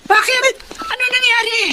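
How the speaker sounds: high, urgent worried questions; sudden fear for her sister; alarmed. Acted. Sister alarmed by Richie's cry.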